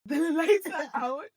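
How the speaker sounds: high, loud and drawn out through laughter; mock-urgent wake-up call, cracking up; laughing alarm. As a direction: announce it loudly while laughing. Reenacts waking partner, laughing.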